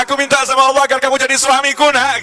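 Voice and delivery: high-pitched hammed-up weeping mother; mock tearful plea. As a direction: wail your confession in mock tears. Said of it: "Ibunya nangis".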